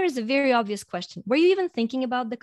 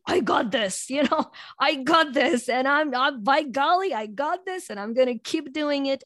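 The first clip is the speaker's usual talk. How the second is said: bold, self-assured pep talk, a little louder; cocky self-talk; 5 semitones higher, 4 dB louder than usual. Retold or imitated. A coach describing power hunger, voicing its cocky confidence. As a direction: pump yourself up like nothing can stop you.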